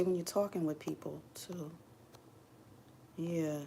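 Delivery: ordinary talk, nothing marked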